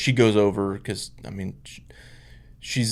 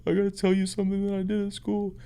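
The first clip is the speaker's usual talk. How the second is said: put-on higher, fast, teary kid voice blurting a confession; tearful daughter; 9 semitones higher, 5 dB quieter, faster than usual. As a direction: play the upset little girl rushing it out. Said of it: "bursts into tears, you know, like".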